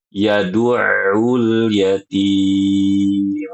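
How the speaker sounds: very slow recitation; Yad'ul yatim drawn out, ain from the throat; correct take. Shown as a demonstration. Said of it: "Harus seperti itu".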